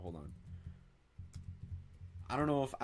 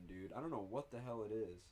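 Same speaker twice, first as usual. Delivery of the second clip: normal talking voice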